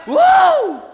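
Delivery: high, loud cheering woo; shout. Real, not acted. Preacher leading the congregation in a victory shout. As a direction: whoop it out as loud and high as you can. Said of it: "Come on, shout".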